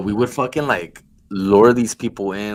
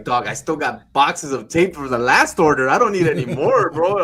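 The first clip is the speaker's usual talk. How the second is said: louder, rising complaint; imitated fed-up caller; exasperated customer; 6 semitones higher, 5 dB louder than usual. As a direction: protest to the pushy salesman.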